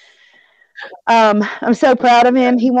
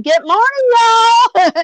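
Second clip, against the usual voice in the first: big high Southern "Good morning, y'all", climbing even higher on y'all; Southern drawl greeting; 13 semitones higher, slower than usual. Retold or imitated. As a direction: sing out a cheery Southern hello, much higher than her own voice. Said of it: "sounded like a Southern".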